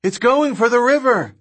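high-pitched urgent shout; shouted alert. A narrator's reading. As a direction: shout the alarm over engine noise. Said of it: "Kelly shouted".

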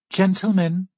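high, loud hostess call. A narrator's reading. Linen-clad hostess to the hunters, calling out. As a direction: ring it out to the whole room. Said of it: "she calls out".

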